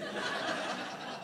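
quiet unvoiced chuckle; soft breathy laugh. Real, not acted. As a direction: let a small laugh slip out. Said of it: "laughs after story".

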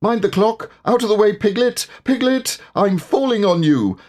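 very high, urgent warnings; frantic, high-pitched alarm; alarmed cry. A narrator's reading. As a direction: call out in rising panic. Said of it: "cried Pooh".

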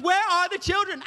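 high, sharp wife question; wife demanding. Retold or imitated. Wife confronting husband about the children, high and sharp. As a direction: press him for the children. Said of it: "she looks at me and goes".

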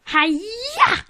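high, drawn-out "hi-ya"; karate cry. Acted. Recapping SpongeBob's attack for the co-host, mock karate cry. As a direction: let out a rising mock karate yell.

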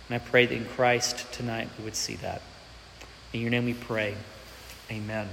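soft, hushed prayer voice. Acted. Pastor opening prayer, hushed and earnest. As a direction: pray quietly and earnestly to God.

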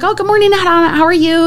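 high-pitched retold hello; cheery greeting. Manager greets banquet server in the hallway, high and cheery. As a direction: greet a favorite coworker way up high.

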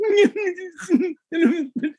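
imitated high squeaks of stifled laughter; suppressed-laughter squeak. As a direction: squeak as if fighting not to laugh. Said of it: "The Queen of France was going".

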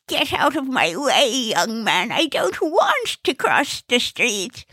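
put-on high, wavering elderly woman; cross old lady. Old lady refuses help crossing, high quavering irritated voice.

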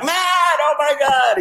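high-pitched, hyped-up imitation of an excited pal; mock giddy greeting, stretched high; always excited friend. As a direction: gush with over-the-top excitement, voice way up. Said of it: "he's just always excited".

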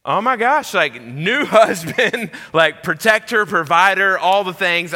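high, loud retold panic; mock terrified. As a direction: ham up a new husband's fright. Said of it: "I feel so scared and terrified".

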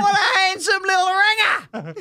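hammed-up high shout, drawn-out words; co-host's girlfriend. Imitating co-host's girlfriend on meeting her, hammed-up shout. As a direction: holler it as an over-the-top impression. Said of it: "his impression of my girlfriend".

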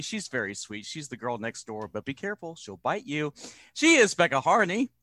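ordinary delivery

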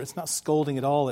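usual speaking voice

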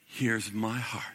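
tender offering. Acted. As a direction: offer it humbly, barely above a breath. Mantle voiced offering his heart.

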